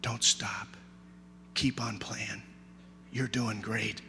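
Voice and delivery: very soft, low, tender encouragement; whispered in ear. Acted. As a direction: hush it down and leave space after each phrase. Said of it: "whispered in your ear".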